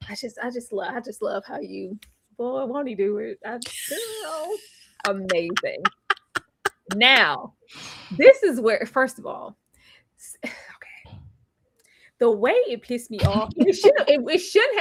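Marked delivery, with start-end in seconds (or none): 0.1-5.8 breathy amused whisper, barely voiced. Real, not acted. Whispers while laughing at friend. 10.2-11.2 long unvoiced sigh, then a hushed okay; weary sigh. Real, not acted. Exasperated sigh before rant.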